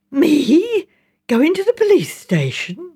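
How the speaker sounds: can't believe he'd suggest it; reproachful incredulity. A narrator's reading. Mrs Baker, scandalised at the suggestion, reproachful disbelief. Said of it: "voice expressed reproach and incredulity".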